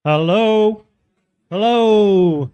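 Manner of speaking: loud drawn-out bingo caller call. Acted. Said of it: "that was a bit like bingo caller".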